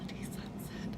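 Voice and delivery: eerie whisper, no voice. Acted. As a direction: breathe Bloody Sunset in a hushed, spooky whisper. Echoes the drink name.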